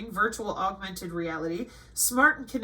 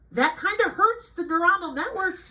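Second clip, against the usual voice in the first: usual speaking voice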